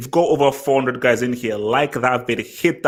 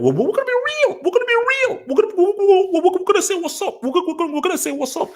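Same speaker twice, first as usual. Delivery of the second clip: much higher falsetto mock voice of the underdog team; mock defiant team voice. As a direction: squeak it out like a cocky cartoon underdog. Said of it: "They stood up and said".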